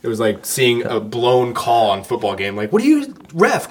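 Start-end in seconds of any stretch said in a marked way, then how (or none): 2.7-3.7 high-pitched protest at the referee; retold outrage. Fan protesting a blown call to the ref, retold outrage. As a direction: cry foul like an indignant sports fan. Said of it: "we were so mad".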